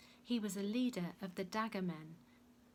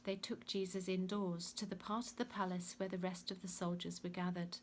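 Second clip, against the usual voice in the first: ordinary delivery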